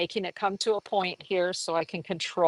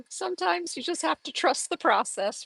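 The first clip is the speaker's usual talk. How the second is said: high-pitched, tickled delivery; light, high voice fresh from laughing; amused high voice; 10 semitones higher than usual. Real, not acted. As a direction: squeak it out on the tail of a laugh. Just after laughing at herself.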